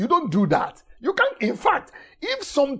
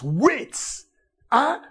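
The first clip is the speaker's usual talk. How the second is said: normal talking voice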